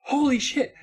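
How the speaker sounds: mock startled exclamation; mock shock. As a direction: overplay the surprise. Mock reaction.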